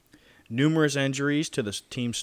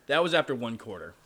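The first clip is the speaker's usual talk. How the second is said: ordinary talk, nothing marked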